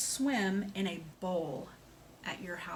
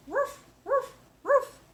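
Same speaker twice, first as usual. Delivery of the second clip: put-on high-pitched, loud puppy woofs for the kids; dog barking; 7 dB louder, slower than usual. Shown as a demonstration. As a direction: bark like a playful little dog. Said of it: "I have an animal that says".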